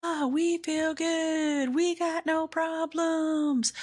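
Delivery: high, slow, carefree inner voice; voice of her insides. Retold or imitated. Her insides telling her all is fine, high and slow. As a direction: play the falsely happy voice inside her. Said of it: "my insides were like".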